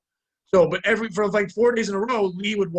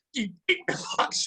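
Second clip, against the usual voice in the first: higher, indignant mock yell; worked-up protest, pitched up; retold yelling; 4 semitones higher than usual. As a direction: act out an outraged accusation without full volume. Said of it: "I'm yelling, I'm like".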